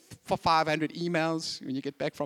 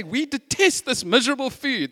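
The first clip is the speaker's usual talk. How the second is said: retold complaint; 7 semitones higher, 3 dB louder than usual. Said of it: "And they're complaining".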